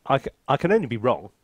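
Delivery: ordinary talk, nothing marked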